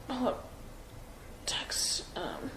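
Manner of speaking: breathy whisper while reading her phone; whispered to herself. Real, not acted. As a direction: mouth the word under your breath as you search. Muttering while searching her phone.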